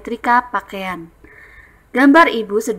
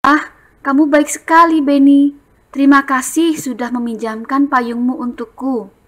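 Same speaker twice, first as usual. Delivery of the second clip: higher, louder grateful delight; reading dialogue; 4 semitones higher, 4 dB louder than usual. Shown as a demonstration. Teacher reading Edo thanking Benny. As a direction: thank him warmly. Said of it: "Bacanya dengan intonasi yang tepat".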